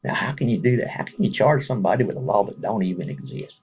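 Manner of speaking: ordinary talk, nothing marked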